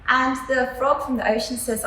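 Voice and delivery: ordinary delivery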